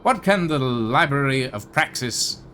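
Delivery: hologram librarian greeting. Acted. Hologram librarian welcoming a visitor to the library, chirpy host voice. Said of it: "standing on it that goes".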